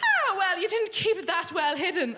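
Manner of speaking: a chirpy mum who saw it coming; teasing mum. Retold or imitated.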